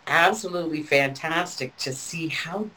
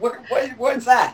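confused husband; 11 semitones higher than usual. Retold or imitated. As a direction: imitate the husband's bewildered "What?". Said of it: "he's just sitting there looking at me and going".

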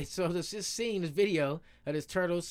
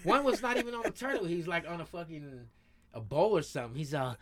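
usual speaking voice

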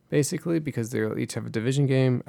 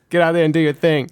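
pep talk; 5 semitones higher, 6 dB louder than usual. Retold or imitated. Continuing the pep talk bit.

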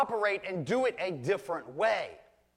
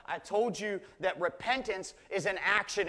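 ordinary delivery

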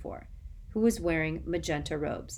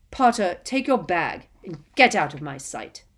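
much louder, cold contempt; angry dismissal; 9 dB louder than usual. Acted. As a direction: throw him out coldly. Snape dismisses Harry.

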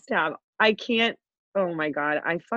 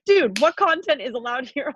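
higher-pitched mock outrage; flipping out; 6 semitones higher than usual. Friend reacting to banned posts, flipping out. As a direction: imitate a friend losing it, voice pitched up. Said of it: "flipping out".

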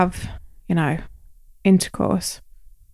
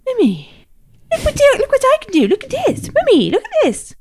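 put-on high, excited little kid showing off; child's voice; 12 semitones higher, 4 dB louder than usual. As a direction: squeal it like a small child begging mum to watch.

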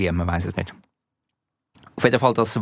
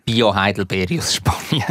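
ordinary talk

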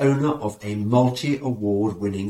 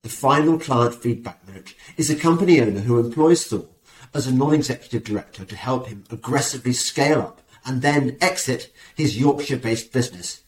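ordinary talk

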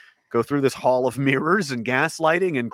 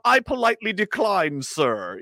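mock polite refusal; 6 semitones higher, slower than usual. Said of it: "you don't say".

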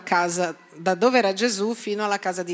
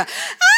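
amazed crowd cry; 5 dB louder than usual. Retold or imitated.

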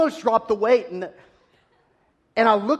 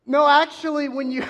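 proud know-it-all reply; 8 semitones higher, 5 dB louder than usual. Retold or imitated. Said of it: "I looked at her and said".

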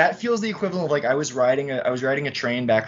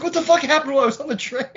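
high, fast stunned outburst; shocked disbelief; 11 semitones higher, faster than usual. Retold or imitated. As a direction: blurt it out in total shock. Shocked reaction retold.

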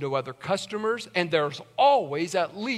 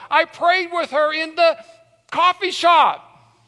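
higher, louder testimony building; preaching at full force; 8 semitones higher, 5 dB louder than usual. Acted. Pastor swearing to his church no one walked in, higher and louder. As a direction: build the miracle to a peak.

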